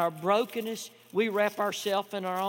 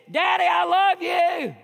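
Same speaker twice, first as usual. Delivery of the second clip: put-on high, loud, slow child's shout; 12 semitones higher, 12 dB louder than usual. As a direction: call it out the car window, much higher. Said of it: "That little boy yells again".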